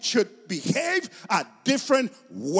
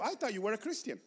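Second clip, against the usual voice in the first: soft, understated challenge; lightly voiced disbelief, dropped in volume; doubting non-believer; 11 dB quieter, faster than usual. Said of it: "This is how they say it to you".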